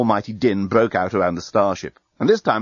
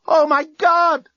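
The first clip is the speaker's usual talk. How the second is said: much higher, louder and slow terror; scream; 5 dB louder, much slower than usual. A narrator's reading. Lucy screaming as the metal rips, high, loud and slow. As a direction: scream it out as the guns hit. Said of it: "screamed Lucy".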